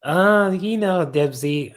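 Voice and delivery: high, loud yawn easing into words. Real, not acted. Explaining to a viewer why she yawned, yawning. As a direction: start talking before the yawn is over. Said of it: "I just yawned".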